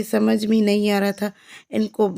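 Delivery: usual speaking voice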